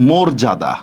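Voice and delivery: মর্যাদা with a rounded "o", low and falling; a low mor-ja-da for মর্যাদা, first vowel closed; correct o vowel. Shown as a demonstration. Teacher repeating the corrected word, মর্যাদা with closed "o". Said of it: "এটা মর্যাদা".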